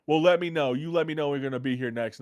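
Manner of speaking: lightly voiced auntie, kindly insistent; sweet older lady.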